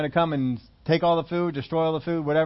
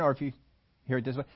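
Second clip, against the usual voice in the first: normal talking voice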